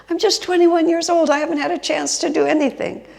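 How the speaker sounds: high, loud acted excuse; mock-protesting young man, loud and high; indignant protest. Voices Richie's defensive excuse.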